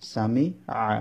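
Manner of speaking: সামিআ split, a pause before the final আ; syllable by syllable. Shown as a demonstration. Teacher showing learners how the letters join, syllable by syllable. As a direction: break সামিআ into its parts.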